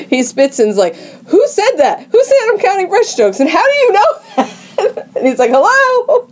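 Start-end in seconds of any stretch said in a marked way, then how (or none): 1.3-5.1 freaked out. Retold or imitated. Man freaked out by narrating voice. 5.5-6.3 high, startled call of hello; freaked out. Retold or imitated. Man calls out to unseen voice.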